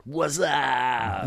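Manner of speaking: high, drawn-out prank-call holler; prank caller. Retold or imitated. People calling all day to prank a bartender, goofy drawn-out holler. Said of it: "people just calling up going".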